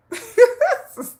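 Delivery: high real laugh; high squeaky laugh. Real, not acted. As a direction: burst out laughing.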